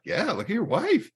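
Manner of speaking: high, pointed retort with a teasing edge; pointed jab. Retold or imitated. Photographer teasing the scowling groom, high and pointed. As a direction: poke the groom about his face, a touch mocking. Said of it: "I'm like".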